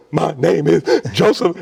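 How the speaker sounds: slow, high, loud sobbing gasp. Retold or imitated. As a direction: choke it out through tears. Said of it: "I could not control myself".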